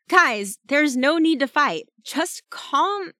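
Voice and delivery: high, loud peacemaking plea; urgent pleading. A narrator's reading. Teammate stopping Shark and Ember from fighting, urgent pleading. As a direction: jump in pleading, pitched well up.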